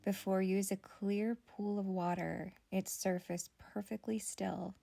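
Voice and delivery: calm guided visualization, phrase by phrase; guided meditation. Acted. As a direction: gently lead the listener inward, unhurried.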